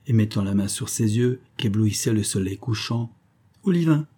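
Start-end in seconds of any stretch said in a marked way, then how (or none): 3.6-4.1 higher-pitched call to the servant. A narrator's reading. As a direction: call the name out, mildly. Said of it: "s'écria-t-il".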